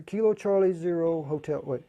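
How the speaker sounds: usual speaking voice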